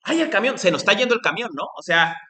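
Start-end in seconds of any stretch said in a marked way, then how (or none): 0.0-0.6 alarmed cry. Retold or imitated. Teacher acting someone running for the departing truck, alarmed and high. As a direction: yelp as the truck leaves.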